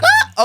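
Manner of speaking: sudden high loud yelp; a squeaky failed tough-guy noise; badly faked macho grunt that cracks up high; strangled yelp. Shows her failed tough act.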